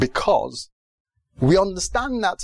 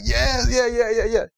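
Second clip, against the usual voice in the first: raised, rapid-fire crowd response; cheering congregation; 7 semitones higher than usual. Retold or imitated. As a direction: imitate an eager crowd answering the preacher. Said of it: "the people there were like".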